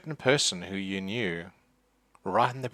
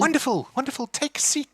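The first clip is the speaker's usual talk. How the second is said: much higher, delighted hostess voice; fussy old lady; 3 dB louder than usual. Acted. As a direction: fuss over the guests in a high old voice. GM voices Agatha hosting.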